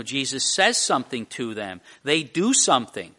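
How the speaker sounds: ordinary talk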